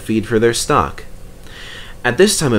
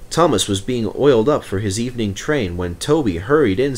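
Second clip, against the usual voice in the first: plain reading voice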